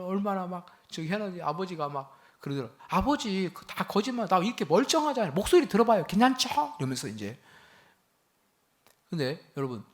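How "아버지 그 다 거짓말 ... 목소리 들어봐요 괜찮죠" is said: reassuring. Retold or imitated. Son reassuring father on phone.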